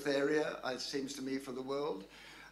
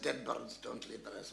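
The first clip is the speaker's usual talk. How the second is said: lightly voiced puzzled repeat, lower and softer; Stalin; 4 semitones lower, 6 dB quieter than usual. Stalin puzzles over Churchill's coded note, lightly voiced low repeat. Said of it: "And Stalin said".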